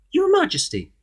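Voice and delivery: very high, formal goblin voice; goblin announcing. A narrator's reading. Goblin bowing before his king, high announcement. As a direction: announce it in a respectful squeak. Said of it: "announced the goblin".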